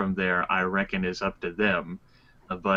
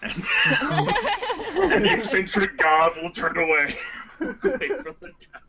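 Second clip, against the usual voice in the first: talking through laughter; laughing; 10 semitones higher, 4 dB louder than usual. Real, not acted. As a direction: laugh while narrating the exit. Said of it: "laughing through narration".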